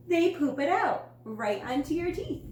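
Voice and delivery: high, loud, sure of himself, easing off at the end; confident yell. A narrator's reading. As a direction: shout it out with total confidence. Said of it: "confidently yelled".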